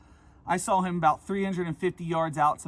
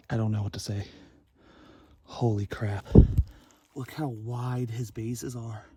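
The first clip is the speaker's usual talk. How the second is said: ordinary talk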